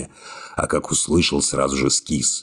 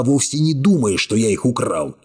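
usual speaking voice

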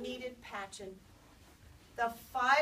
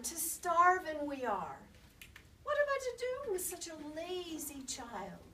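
Gram complaining; 7 semitones higher than usual. Acted. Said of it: "cried Gram".

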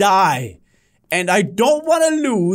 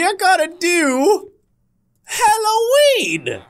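spooky voice; 8 semitones higher, slower than usual. Pretend. Spooky slow theme announcement.

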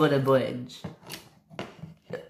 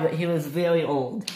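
plain reading voice

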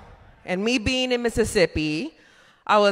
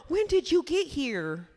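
slow, higher mimicked question; restaurant lady; 4 semitones higher, 5 dB quieter than usual. Lady questions the comic, lightly mimicked slow, higher voice. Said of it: "She's like".

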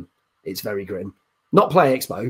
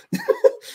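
high squeaky laugh. Real, not acted. Laughing at friend's reaction.

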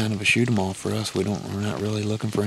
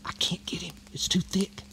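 wildlife watching whisper; 9 semitones higher, 5 dB quieter than usual. Real, not acted. Hunters whispering at a deer.

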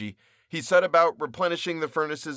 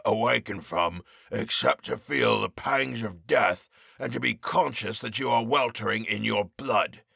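croaking harsh voice. A narrator's reading. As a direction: rasp the order out like a jailer. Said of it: "croaking and harsh".

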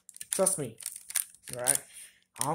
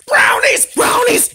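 excited shriek; 10 dB louder, slower than usual. Pretend. Toy cheering for brownies.

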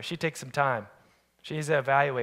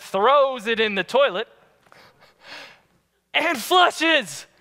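ordinary delivery

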